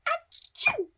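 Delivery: high, loud acted achoo; sudden piercing achoo; explosive sneeze. A narrator's reading. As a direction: burst into a squeaky-high fake sneeze. Elephant sneezes again.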